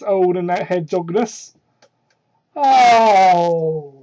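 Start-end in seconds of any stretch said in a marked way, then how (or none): 2.5-4.0 mock moan. Voicing moan in read fanfic.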